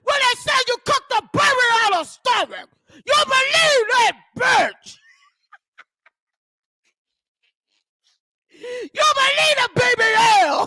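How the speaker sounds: loud, high mocking shout; mock shouting rant. Mocking a reality star loudly for her beliefs.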